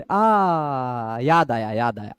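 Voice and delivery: low, soft "आ, याद आया" as it comes back; recalling aa. Shown as a demonstration. Teacher acting out someone suddenly remembering, low and soft. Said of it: "ऐसे बोलते हैं ना".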